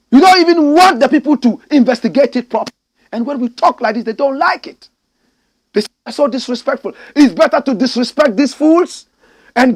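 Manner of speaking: loud, high angry tirade; furious rant. Real, not acted. Ranting at a foolish president, loud and furious. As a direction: tear into them at full volume.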